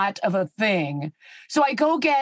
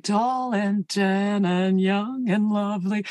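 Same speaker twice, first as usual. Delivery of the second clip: a hint of a slow lounge croon; lounge singer; slower than usual. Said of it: "like a lounge singer".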